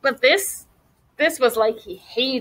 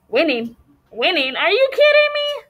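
mock outrage at the word winning; scoffing excitement; 6 semitones higher than usual.

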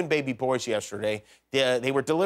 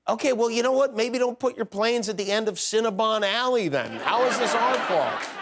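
higher-pitched, defensive comic retort; mock indignant; 6 semitones higher than usual. Passenger answering airline about weight gain, mock indignant. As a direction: push back in mock offense, pitch up.

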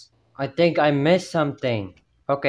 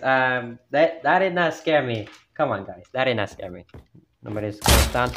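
ordinary talk, nothing marked

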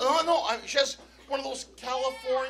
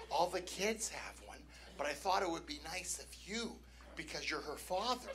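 quiet, low imitation of coaxing; soft retold coaxing; 7 semitones lower, 13 dB quieter than usual. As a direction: murmur it softly, as retold persuasion. Retelling partner's pleading request.

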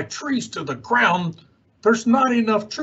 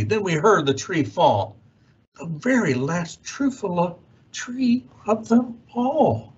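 ordinary delivery

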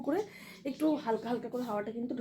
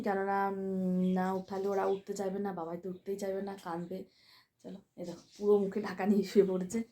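ordinary talk, nothing marked

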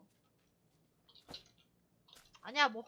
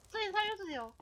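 ordinary talk, nothing marked

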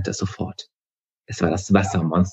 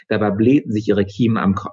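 usual speaking voice

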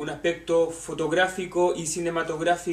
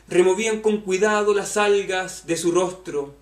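louder, projected poetry reading; poem recitation; 2 semitones higher, 7 dB louder than usual. Acted. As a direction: recite the verses with measured projection. Reads a poem aloud.

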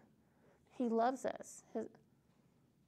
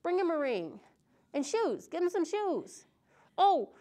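a lightly voiced father, excited and a bit higher; joyful father; 4 semitones higher, 5 dB louder than usual. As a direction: call out orders like a delighted dad.

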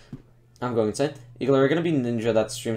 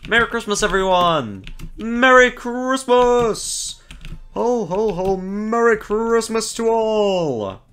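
jolly ho ho ho; 8 semitones higher, slower than usual. Pretend. Festive Santa-style greeting.

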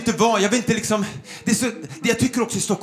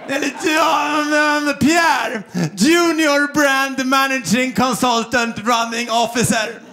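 pretentious title-holder; 3 semitones higher, slower than usual. Acted. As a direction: stretch the English title out with mock importance. Mocking inflated Stockholm job titles.

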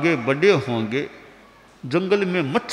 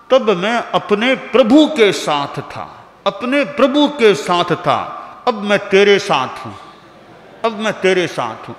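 louder, measured stress on Prabhu and तेरे; emphatic lesson; 3 semitones higher, 4 dB louder than usual. Acted. Guru teaching disciple, emphatic repeated lines. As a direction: drive home the difference.

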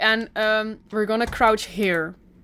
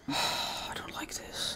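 frightened whisper; hushed and scared, breathy; 12 dB quieter, much slower than usual. Real, not acted. Scary game, hiding from animatronics.

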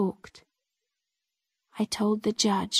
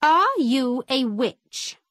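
lightly voiced judge, slow and pointed; slow, measured interrogation; judge questioning; 3 semitones higher, 3 dB louder, slower than usual. A narrator's reading. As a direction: put a slow courtroom question to the accused. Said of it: "Judge Bromley asked my mother".

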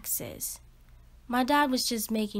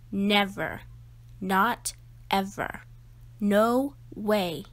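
ordinary delivery

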